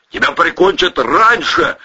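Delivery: high, upbeat retort; optimistic prediction. A narrator's reading. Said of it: "оптимистично предрёк Протасов".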